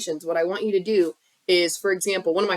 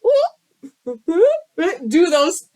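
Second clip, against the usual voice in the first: laughing through a dirty joke; giggly, high, loud; giggling; 8 semitones higher, 5 dB louder, much slower than usual. Real, not acted.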